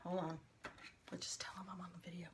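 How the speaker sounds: whisper. Real, not acted. Aside to viewers as husband arrives.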